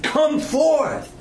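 high, commanding call. Retold or imitated. Jesus calling Lazarus from the dead, commanding.

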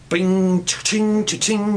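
ringing cha-ching sound effect; drawn-out ringing "ching"; imitated cash register. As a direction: mimic coins ringing up in his head. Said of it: "That's all he hears in his head".

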